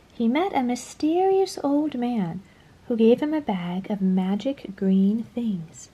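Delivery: ordinary delivery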